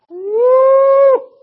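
loud, high laughing whoop of joy; joyful whoop. Real, not acted. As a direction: let out a big happy holler. Preacher whoops in excitement.

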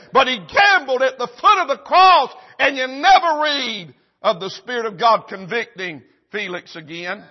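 loud, high fiery preaching; impassioned revival preacher; preaching at full force. Acted. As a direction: drive it home from the pulpit.

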